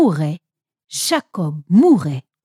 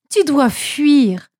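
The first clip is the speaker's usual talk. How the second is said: voix haute, mots lents et appuyés; ordonne-lui de fuir en détachant les mots; mise en garde pressante; 6 semitones higher, 4 dB louder, slower than usual. A narrator's reading. Mère presse son fils de fuir. (mère grave et pressante)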